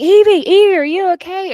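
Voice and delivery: high, loud retold cry of concern; alarmed calling out. Boss to Evie after a cannonball nearly hits, alarmed retold call. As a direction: imitate an urgent check on someone, pitched well up.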